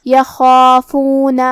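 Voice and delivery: "یخافون" very slow, with a heavy throaty خا and a heavy long alif; "یخافون" with emphatic خا and a drawn-out full alif; heavy kha. Shown as a demonstration. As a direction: stretch "یخافون" and thicken the خا from the upper throat. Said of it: "تو ہم خا کو اور مد الف کو دونوں کو بھاری آواز".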